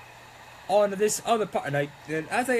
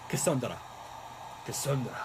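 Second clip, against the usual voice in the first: put-on lower, playful accented Cassandra; name in an accent; 6 semitones lower, 7 dB quieter than usual. Crafter playing with a viewer's name, lightly accented. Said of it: "saying people's names in the different accents".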